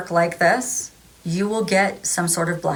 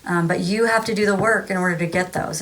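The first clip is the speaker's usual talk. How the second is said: ordinary delivery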